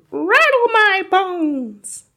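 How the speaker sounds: high, loud, long-drawn wail; spooky ghost voice. A narrator's reading. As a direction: wail slowly like a haunting ghost. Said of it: "soft and spooky this time".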